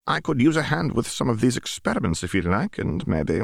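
put-on an eager old scientist offering help; lightly voiced elderly mentor; Heimerdinger's voice. A narrator's reading.

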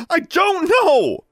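shouting back. A narrator's reading. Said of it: "Alexandra shouted back".

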